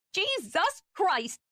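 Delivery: high, drawn-out angry explosion; explosive outburst. Acted. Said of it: "she exploded".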